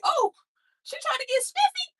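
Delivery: high, loud, mocking exclamation; mocking inner voice. At a track meet, sizing up the girl beside her, a high loud mocking squeal. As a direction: squeal it like you caught her showing off. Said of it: "I'm like".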